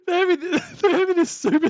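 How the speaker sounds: giggling through the words; breathless laughter mid-sentence; laughing. Real, not acted. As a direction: talk while cracking up. Laughing while describing the clip.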